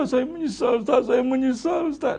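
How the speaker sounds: a penitent owning up, lightly acted; mock remorse. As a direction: congregant confessing to the ustaz, mild mock regret. Voices a remorseful congregant.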